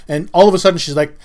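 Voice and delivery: ordinary talk, nothing marked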